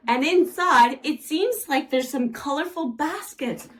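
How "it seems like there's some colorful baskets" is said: normal talking voice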